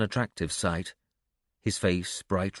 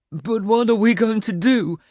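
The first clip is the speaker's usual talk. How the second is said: put-on high, loud pleading boy voice; on verge of tears; 13 semitones higher, 6 dB louder than usual. A narrator's reading. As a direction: plead as if tears are about to return. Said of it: "tears threatening to break loose again".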